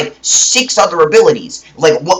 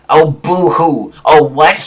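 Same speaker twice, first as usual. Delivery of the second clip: drawn-out mock sympathy; sarcastic, taunting boo-hoo; slower than usual. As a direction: jeer at a crybaby. Mocks imagined objection.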